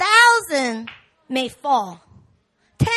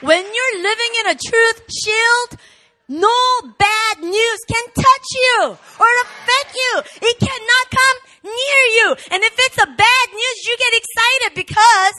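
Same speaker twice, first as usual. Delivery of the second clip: loud, high shouted sermon; full-force preaching; 8 semitones higher, 8 dB louder than usual. Real, not acted. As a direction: drive the message home at full volume.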